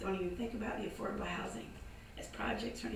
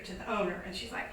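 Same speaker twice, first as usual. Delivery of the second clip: ordinary delivery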